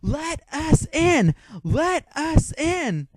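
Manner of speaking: high, drawn-out yelled chant; yelling out in protest, high and slow; imitated crowd yell. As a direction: call it out like an impatient crowd, stretching each word. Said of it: "were yelling out".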